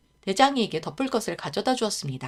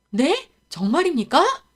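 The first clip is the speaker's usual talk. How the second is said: higher, louder stunned joy; boy hearing his reward; astonished; 8 semitones higher, 8 dB louder, slower than usual. Acted. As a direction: gasp in delighted disbelief. Boy given palace job.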